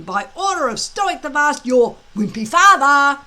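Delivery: loud mocking bully jeer; sneering. A narrator's reading. Bully Snotlout sneering at Hiccup about his dragon. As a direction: sneer it down at him. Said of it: "sneer at Hiccup".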